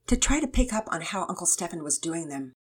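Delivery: ordinary delivery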